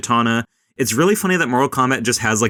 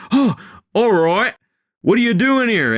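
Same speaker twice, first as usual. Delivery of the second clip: put-on slipping Australian accent; 11 semitones higher than usual. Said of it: "Your accent came out".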